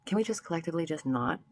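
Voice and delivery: ordinary delivery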